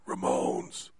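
barely voiced whisper; very quiet. Patient whispering a joke while his skull is drilled. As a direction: breathe the word out during surgery. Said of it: "I said really quietly".

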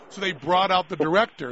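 ordinary talk

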